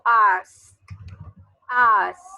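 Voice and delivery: "ask" said loud, ending on a crisp unvoiced k; model English word. Shown as a demonstration. Teacher showing students the dry k ending of "ask", loud model. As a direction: snap the final k of "ask" with no voice. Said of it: "termina un sonido k".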